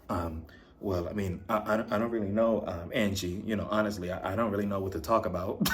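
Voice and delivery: low, soft, hesitant mumble; mumbling Sean impression. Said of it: "looking suspicious like".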